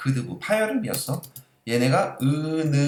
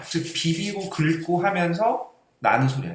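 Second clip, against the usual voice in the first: ordinary talk, nothing marked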